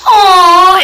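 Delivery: normal talking voice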